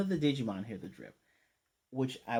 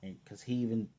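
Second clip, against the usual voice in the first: ordinary delivery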